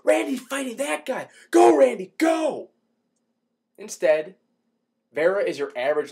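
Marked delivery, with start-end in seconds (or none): 0.0-2.7 much higher and louder, rising into a full shout; cheering fan. Retold or imitated. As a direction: cheer him on like a hyped fan in the crowd. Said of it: "someone you look at and say".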